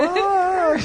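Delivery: high falsetto shriek; agudo falsete. Shown as a demonstration. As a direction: show off a sky-high falsetto note. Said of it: "canto más agudo". (grito agudo en falsete)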